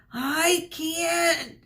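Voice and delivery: high, strained yell; high panicked yell. A narrator's reading. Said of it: "Sheeran yelled".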